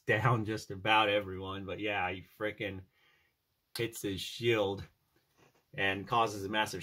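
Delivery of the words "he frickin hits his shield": usual speaking voice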